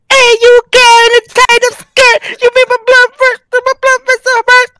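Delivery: high Jamaican patois toasting; island music voice. Retold or imitated. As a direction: chant it like a dancehall MC. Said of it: "I'd be like".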